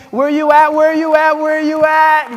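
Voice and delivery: high, loud call to the crowd. Real, not acted. Speaker calls out to University of New Haven alumni in the audience, loud and high. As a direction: shout it out to find fellow graduates.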